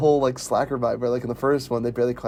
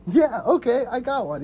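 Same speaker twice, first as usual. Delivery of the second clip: eager quote; 10 semitones higher than usual. Retold or imitated. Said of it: "He's like".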